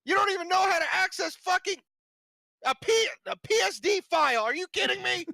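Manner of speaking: mock rant. Student ranting at the graphic design teacher, high angry yell. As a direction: rage at her like a wronged student.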